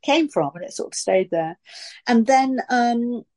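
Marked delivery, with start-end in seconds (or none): none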